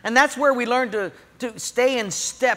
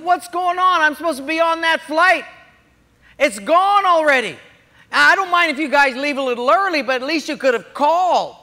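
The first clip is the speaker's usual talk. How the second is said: loud, higher, indignant complaint; 7 semitones higher, 6 dB louder than usual. Retold or imitated. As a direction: protest to the gate agent. Complaining flight left early.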